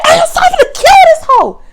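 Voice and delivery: very high, loud imitated threat; hammed-up furious coworker; mock threat. Coworker threatens her, retold.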